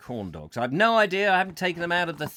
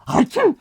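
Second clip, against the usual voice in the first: loud, high pretend sneeze; fake sneeze; 12 semitones higher, 8 dB louder than usual. Presenter sneezing at his visitor, fake sneeze. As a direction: explode into a comic sneeze.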